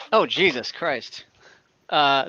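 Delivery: ordinary delivery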